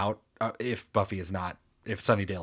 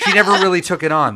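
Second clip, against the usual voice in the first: much higher and louder on never really; emphatic; 9 semitones higher, 13 dB louder, faster than usual. Real, not acted. Host insisting Buffy never took it on, emphatic outburst. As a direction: really punch it out, exasperated. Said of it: "emphatic rant".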